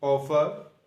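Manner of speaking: normal talking voice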